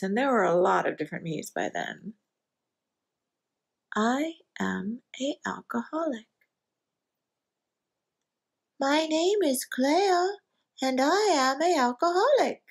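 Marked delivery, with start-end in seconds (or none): 3.9-12.6 put-on little girl voice. Confessing to the meeting as a little girl, in a little girl voice. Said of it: "in my little girl voice".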